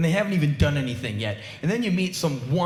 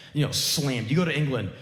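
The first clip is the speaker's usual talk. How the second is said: ordinary talk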